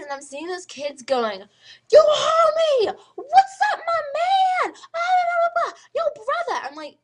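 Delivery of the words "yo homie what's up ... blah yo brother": drawn-out, very high hollering; loud, hammed-up wannabe gangster; mock homie shouting. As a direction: exaggerate a swaggering kid yelling down the hallway. Mocking kids in school hallways.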